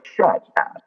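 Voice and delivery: high, stunned "shut up"; shocked. Retold or imitated. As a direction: blurt it out in disbelief. Daughter's shocked reply in car.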